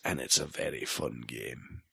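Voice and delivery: usual speaking voice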